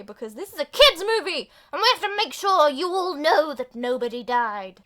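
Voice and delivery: loud, exaggerated proclaiming; mock emphatic declaration. Mock insistence on kids' film.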